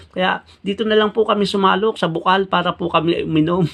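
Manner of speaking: pleading explanation. Acted. Mangyan explains to owner.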